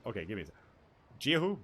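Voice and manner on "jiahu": Jiahu high and loud, rising as "jee-hoo?"; unsure attempt at Jiahu. Shown as a demonstration. Streamer practising the name Jiahu, doubtful. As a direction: trial run of Jiahu as a question. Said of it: "I will try my damnedest".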